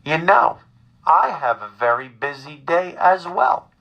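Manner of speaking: deliberate, measured mother voice; mother impression. Son imitates his mother on the phone, measured and insistent. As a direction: spell it out as a put-upon mother. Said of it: "This is my mother".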